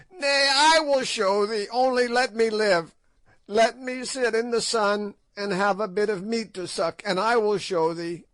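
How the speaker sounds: high, loud crone voice, pleading; high hag voice, whining for mercy; begging old witch. A narrator's reading. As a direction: grovel for your life. Witch begs for her life.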